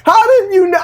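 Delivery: high burst of laughter, words breaking through; laughing in disbelief. Real, not acted. Podcast co-host laughing at his partner's identical thought, high and giggling. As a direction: crack up at being beaten to the joke.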